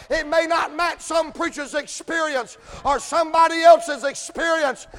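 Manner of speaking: loud, high, slow shouted preaching; full-force preaching. Acted. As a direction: rise into a hollering sermon climax.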